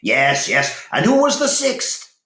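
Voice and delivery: high, loud eager shout. A narrator's reading. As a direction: demand the answer eagerly. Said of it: "shouted Cedric eagerly".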